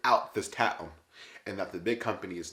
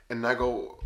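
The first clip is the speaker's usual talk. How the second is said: ordinary delivery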